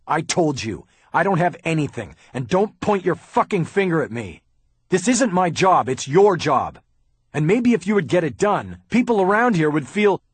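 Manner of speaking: loud, high angry shouting. Acted. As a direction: yell back in fury. Haller yells back at Bosch.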